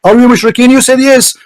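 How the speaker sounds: usual speaking voice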